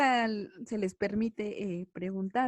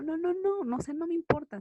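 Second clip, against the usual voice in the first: higher-pitched, insistent string of refusals; dismissive teacher; 8 semitones higher than usual. Acted. Teacher to pupil who wants a purple sun, dismissive. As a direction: brush off the pupil's question in a raised pitch.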